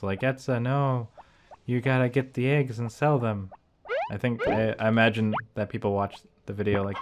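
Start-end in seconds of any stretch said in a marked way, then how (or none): none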